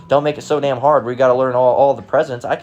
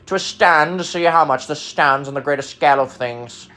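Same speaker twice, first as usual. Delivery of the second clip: hammed-up pompous sketch voice, starting very high; mock Monty Python toff; 4 semitones higher than usual. Mocking big words nobody uses, pompous Monty Python voice. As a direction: parody an absurdly stuffy intellectual. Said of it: "What are you, a Monty Python skit?".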